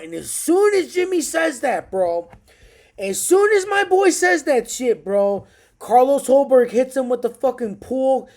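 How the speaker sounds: rising hype, loud and high; pumped fight fan shouting the recap; excited. Real, not acted. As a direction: yell out the knockout like a fan.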